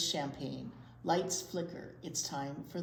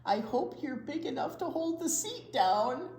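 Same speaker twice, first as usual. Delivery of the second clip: grandpa voice; 8 semitones higher, 4 dB louder than usual. Acted. Grandpa in read-aloud story.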